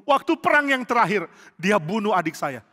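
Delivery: heated, still bitter; angry grievance. Acted. Joab tells David when Abner killed his brother, angry.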